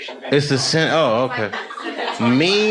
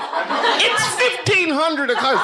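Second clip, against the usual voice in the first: higher, slow mocking clock talk; mock military time; much slower than usual. Comic mocking a British fan's clock, high mock pompous. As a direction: lightly sneer it like a pompous official.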